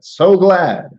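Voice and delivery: loud, high burst of gladness; exultant read-aloud cry; exclamation. Acted. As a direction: read it with a big exclamation mark. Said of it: "Exclamation point".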